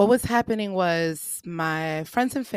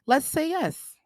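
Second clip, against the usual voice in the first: coaxing suggestion; 9 semitones higher than usual. Retold or imitated. Counselor's retold suggestion.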